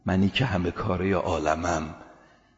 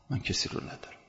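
beaten whisper; 10 dB quieter than usual. Retold or imitated. Defeated enemy admits helplessness.

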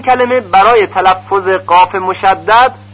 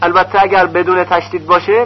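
usual speaking voice

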